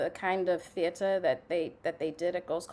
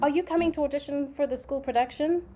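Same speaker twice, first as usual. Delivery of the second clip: higher-pitched, brisk teacher greeting a pupil; imitating drama teacher; 8 semitones higher, faster than usual. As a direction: play the drama teacher inviting a student to audition. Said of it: "But she goes".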